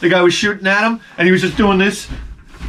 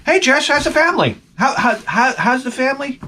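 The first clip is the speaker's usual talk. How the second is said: high, overly cheerful coworker greeting; chirpy nice guy; 9 semitones higher than usual. Retold or imitated. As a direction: play the too-nice guy, pitched up.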